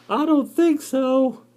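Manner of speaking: hammed-up sassy spider; sassy spider refusal. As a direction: drag out the no-way.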